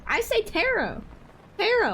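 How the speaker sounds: tarot pitched high, falling, ending in "oh"; tarot said "TARE-oh". Shown as a demonstration. Streamer defending their pronunciation, tarot said as "TARE-oh". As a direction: say tarot as "TARE-oh", no T heard at the end. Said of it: "I have the T at the end".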